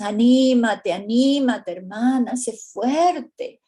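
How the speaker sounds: loud, cheering encouragement; rally a grieving sister, a bit hammed-up; imitating a pushy cheerleader at a funeral; mock pep talk. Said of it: "Otras veces le decimos".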